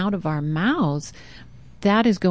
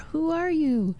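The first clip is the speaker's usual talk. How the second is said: the question returns much softer; faint echo; 8 semitones higher, 5 dB quieter than usual. Retold or imitated. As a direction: echo it back from far away. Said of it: "He heard an echo".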